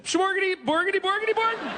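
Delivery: very high Swedish chef gibberish; Muppet Swedish chef. Retold or imitated. Mocking how Swedes talk on the Muppet Show, high gibberish. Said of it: "That's how they talk".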